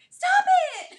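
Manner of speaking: very high squeal, loud and playful; squeaky high protest. Retold or imitated. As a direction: squeal it like a teasing complaint. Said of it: "she'll be going".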